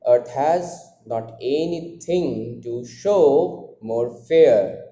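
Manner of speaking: drawn-out verse demo with "Earth" stressed first; metrical stress reading. Shown as a demonstration. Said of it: "I am putting the stress on earth".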